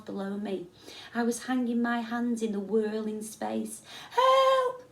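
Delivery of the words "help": shrieked, very high and loud; panicked scream. A narrator's reading. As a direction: scream for help in terror. Boy hanging in panic.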